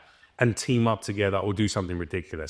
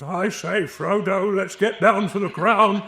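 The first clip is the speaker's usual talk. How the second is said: put-on higher, slow wizardly old voice; hammed-up Gandalf voice; Gandalf impression; 9 semitones higher, 3 dB louder, slower than usual. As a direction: channel a grand old wizard addressing his hobbit. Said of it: "we can both do the voices".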